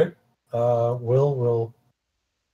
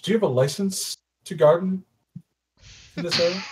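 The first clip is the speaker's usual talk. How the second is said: higher, playful teasing question; joking; 5 semitones higher than usual. Acted. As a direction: tease him like it's a joke. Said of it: "he says it like, like you know he's joking".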